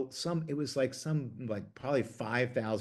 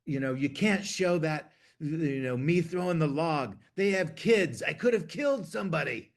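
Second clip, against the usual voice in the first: worked-up [name] impression, higher and a bit louder; [name] pleading; 6 semitones higher, 3 dB louder than usual. [name] phoning the producer about the log, rising urgent plea. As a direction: voice [name] insisting, pitch climbing. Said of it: "He said".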